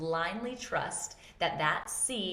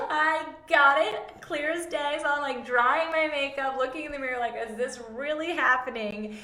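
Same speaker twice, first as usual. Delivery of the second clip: high keyed-up telling; 9 semitones higher, 7 dB louder than usual. Retold or imitated. She recalls crying at the mirror, keyed up. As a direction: tell it still buzzing from it. Retold shaken, high loud.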